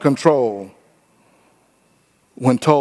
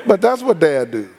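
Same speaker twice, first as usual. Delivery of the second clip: normal talking voice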